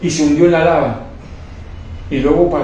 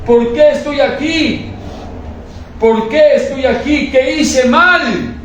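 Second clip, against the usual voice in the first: high, slow, desperate questioning; slow anguished plea in a raised voice; pleading to God; 9 semitones higher, much slower than usual. Retold or imitated. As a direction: plead with God, not understanding why. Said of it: "Yo le decía a Dios".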